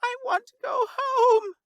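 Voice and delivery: very high, loud sobbing cry; sobbed out. Acted. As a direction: wail it like a terrified child. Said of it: "Fane sobbed out".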